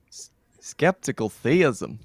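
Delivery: slow, hesitant American accent; a Brit trying an American accent; badly faked American accent, drawn out; American accent attempt. Shown as a demonstration. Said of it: "I'll prep an American accent".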